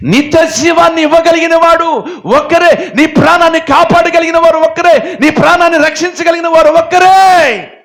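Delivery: high, loud sermon at full force; fiery preaching. Real, not acted. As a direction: thunder it at the congregation. (ఉద్రేకంగా గట్టిగా ప్రసంగం)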